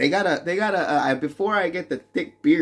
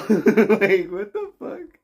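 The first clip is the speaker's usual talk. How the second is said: laughing disbelief; 7 semitones higher, 4 dB louder, slower than usual. Real, not acted.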